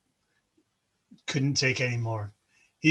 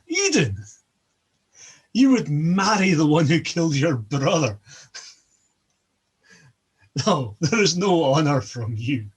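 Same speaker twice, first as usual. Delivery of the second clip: scornful outburst; 2 semitones higher, 6 dB louder than usual. A narrator's reading. Loki scorning Idun before the hall, loud and sneering. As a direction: spit the words at her with a sneer.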